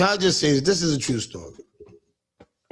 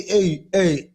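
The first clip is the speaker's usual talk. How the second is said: usual speaking voice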